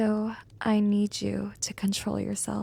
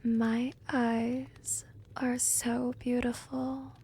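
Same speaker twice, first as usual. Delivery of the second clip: slow, soft hypnotist's voice with long pauses; hypnotic trance induction; 5 dB quieter, slower than usual. Acted. Vampire putting a werewolf into a trance, slow and hypnotic. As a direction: lull them under, slowly and gently.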